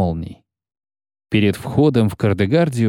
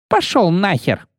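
rude shout; 9 semitones higher than usual. Acted. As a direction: toss him out with contempt. Commandant throws him out.

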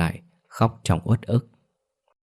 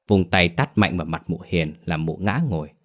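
usual speaking voice

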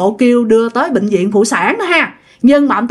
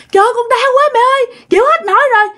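much higher, fast, hammed-up crying plea; overacted pain; 11 semitones higher, faster than usual. Mother overacting labor pain to rush grandma, high and fast. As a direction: wail that you can't take it anymore, overdoing it. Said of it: "do mẹ làm quá".

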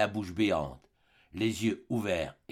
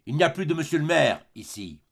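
scornful sneer; 5 semitones higher, 5 dB louder than usual. Acted. Javert mocks the arrested mayor.

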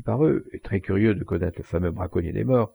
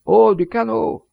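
a long, slow, much higher and louder hail; hailing a boat; 12 semitones higher, 8 dB louder, slower than usual. A narrator's reading. Count hailing the poacher's boat from his yacht, slow loud hail. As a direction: stretch the call out over the sea.

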